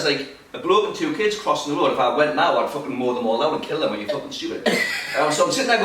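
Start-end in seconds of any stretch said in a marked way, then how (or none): none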